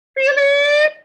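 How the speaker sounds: high, loud shout across the house; mother's loud call. Acted. Mother calling Philip from the kitchen, loud high shout. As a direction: yell his name from the kitchen.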